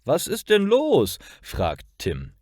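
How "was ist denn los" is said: high, lively voice for Tim; cheerful questioner. A narrator's reading. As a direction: ask it brightly, pitched well up. Cheerful Tim questions sleepy Lara.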